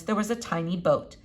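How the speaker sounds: ordinary delivery, nothing marked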